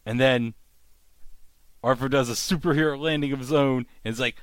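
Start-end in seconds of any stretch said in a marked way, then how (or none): none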